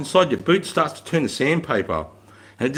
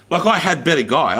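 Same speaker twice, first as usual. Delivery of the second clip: ordinary talk, nothing marked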